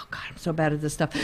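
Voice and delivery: whispered embarrassed aside; whispering. Real, not acted. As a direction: whisper it to yourself, flustered. Embarrassed aside while stuck.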